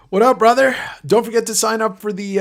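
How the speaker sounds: high, loud greeting; excited greeting. Real, not acted. Greeting a chatter.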